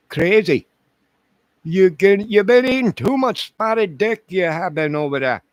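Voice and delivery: high, loud hammed-up ranting; loud mock rant. Host ranting at crazy Scots, loud hammed-up rant. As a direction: holler it as an over-the-top scolding.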